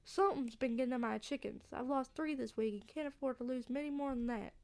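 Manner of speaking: Southern farmer with a grievance; worried country local, Southern accent. Acted. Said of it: "a southern accent".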